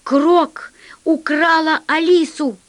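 high, slow narrated cry; alarmed shout. A narrator's reading. Crewmate telling the pilot of the kidnapping, alarmed shout. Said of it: "крикнул Зелёный".

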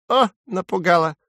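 high little bird scoff; high-pitched sparrow sarcasm; mocking sparrow. A narrator's reading. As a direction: pipe it up in mock fright. Sparrow mocks the crow's threat.

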